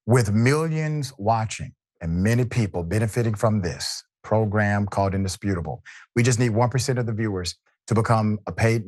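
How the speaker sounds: punchy ad read asking viewers to join; membership pitch. Acted. Sponsor appeal read.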